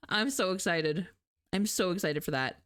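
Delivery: ordinary talk, nothing marked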